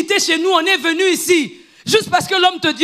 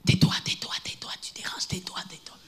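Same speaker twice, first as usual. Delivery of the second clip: whispered shushing of the crowd; hushing whisper; 8 semitones lower, 12 dB quieter than usual. Retold or imitated. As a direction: hiss him into silence. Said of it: "La foule commence à lui dire". (chuchotement de la foule qui fait taire)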